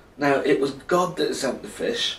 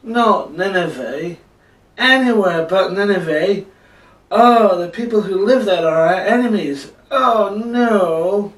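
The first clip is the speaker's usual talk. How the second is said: groaning complaint; 6 semitones higher, 7 dB louder than usual. Acted. Jonah protests being sent to his enemies, groaning. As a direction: groan dramatically, rising into a louder wail. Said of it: "he groaned".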